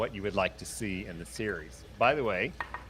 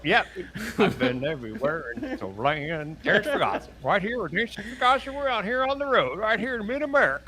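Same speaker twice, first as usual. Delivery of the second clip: high twangy Southern trucker voice; a trucker drawling on the road in Southern accent; 11 semitones higher, 3 dB louder than usual. Pretend. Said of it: "You do that really well, like you're from Tennessee".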